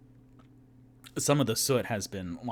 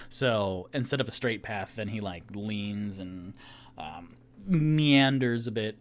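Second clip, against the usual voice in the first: normal talking voice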